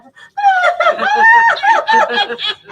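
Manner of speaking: very high, loud burst of laughter; shrieking laugh. Real, not acted. Howling with laughter at meeting the governor. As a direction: howl with laughter.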